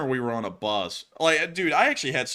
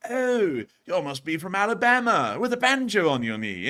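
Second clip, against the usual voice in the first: higher, jolly mock British accent; friendly British guide; 7 semitones higher, 4 dB louder than usual. British tour guide teasing Alabamians, friendly mock British accent. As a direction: imitate a friendly Brit, pitched up. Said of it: "And he said".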